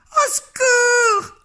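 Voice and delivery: very high, slow, loud terrified scream; crying for help. A narrator's reading. Mrs Jenkins screaming for help at the mouse, high and terrified. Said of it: "criait madame Jenkins".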